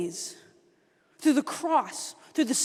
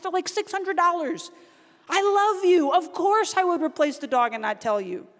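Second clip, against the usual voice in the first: higher-pitched, exasperated fatherly justification; loving self-defense; 3 semitones higher than usual. Retold or imitated. Dad defending replacing his daughter's dog, exasperated but loving. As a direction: plead the case as a loving dad.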